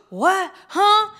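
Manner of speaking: high, startled what and huh; mock shocked reaction; shocked gasp. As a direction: gasp as if you can't believe it. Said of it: "people were like".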